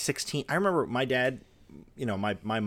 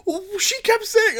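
very high, loud and slow mock mom; flustered mom; 8 dB louder, slower than usual. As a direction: wail it high in a panic. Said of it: "And she goes".

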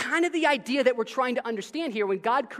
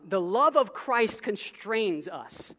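slow, weighty quote; solemn verse read slow; slow scripture reading; much slower than usual. Acted. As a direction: let each word land slowly.

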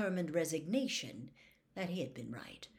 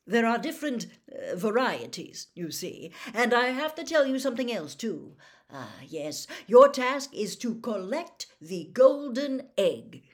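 loud, higher-pitched showman giving instructions; hearty official; 4 semitones higher, 10 dB louder than usual. Acted. Bagman tells the champions their task, hearty and loud. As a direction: play a booming, excitable contest official.

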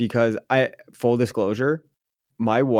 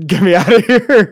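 high and loud, laughing it out; a laughing escape plea; 8 semitones higher, 10 dB louder than usual. Retold or imitated. Telling the clerk he just wants out, laughing, higher and louder.

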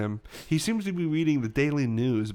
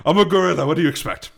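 louder cockney gorilla; a bit cockney; 4 semitones higher, 8 dB louder than usual. Acted. Gorilla answering the accent jibe, louder cockney. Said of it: "you've got a bit cockney".

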